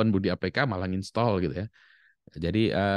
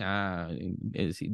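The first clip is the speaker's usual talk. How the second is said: normal talking voice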